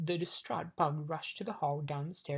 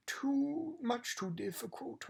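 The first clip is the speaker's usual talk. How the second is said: high, very slow royal voice; kingly refusal; 10 semitones higher, much slower than usual. A narrator's reading. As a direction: draw out each word dismissively. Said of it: "replied the king".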